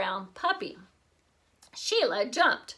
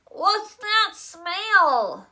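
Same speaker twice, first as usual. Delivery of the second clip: slow, nasal-held-nose question; pinched-nose voice; 9 semitones higher, 6 dB louder, much slower than usual. A narrator's reading. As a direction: talk while holding your nose. Holding her nose.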